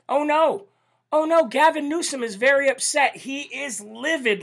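high, far-off mock dismay. Mocking Newsom's upset in a put-on voice. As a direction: fake sympathy for a pouting governor.

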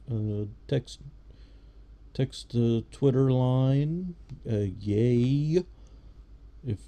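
congressman voice. Shown as a demonstration. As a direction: hold the floor like a pompous congressman. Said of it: "this is my congressman voice".

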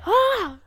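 short, high, loud imitation of a scream; mock scream. Cohost imitating a girl's reaction at the club, mock scream. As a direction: cry out like the startled girl. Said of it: "Like, she screamed".

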